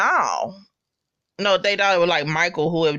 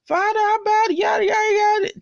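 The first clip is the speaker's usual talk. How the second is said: much higher, loud and dramatic; mock fervent prayer; 13 semitones higher, 3 dB louder than usual. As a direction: ham up a martyr crying to heaven. Meade's last testimony, acted out.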